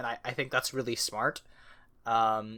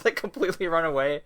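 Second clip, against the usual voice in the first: usual speaking voice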